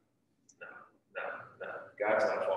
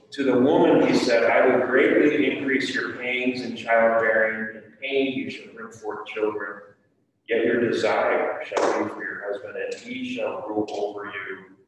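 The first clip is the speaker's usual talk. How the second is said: scripture reading; 2 semitones higher, 10 dB louder than usual. Acted. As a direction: proclaim it to the class. Reads verse sixteen aloud.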